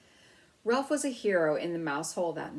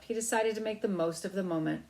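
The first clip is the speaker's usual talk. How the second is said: ordinary delivery, nothing marked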